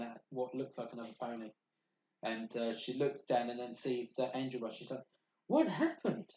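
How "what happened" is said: high, swooping startled question; in-character shocked question; startled cry. Acted. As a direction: exclaim it high and loud in alarm. Game master voices startled pony.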